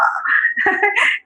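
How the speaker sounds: a short airy laugh, high and light; breathy laugh. Real, not acted. Laughing at her pandemic worries. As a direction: laugh at how hard it got.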